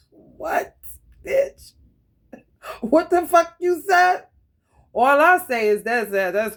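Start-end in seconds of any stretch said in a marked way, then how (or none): 0.1-4.3 mock outraged shout. Calling out a stranger, mock outraged shout. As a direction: snap back in fake outrage, voice jumping up.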